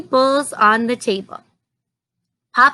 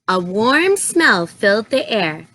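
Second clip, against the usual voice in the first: ordinary delivery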